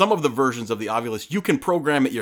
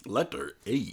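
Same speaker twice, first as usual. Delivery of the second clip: more robotic speak and spell; 8 dB quieter, slower than usual. Retold or imitated. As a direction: stiff mechanical toy speech. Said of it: "it's even more robotic than that".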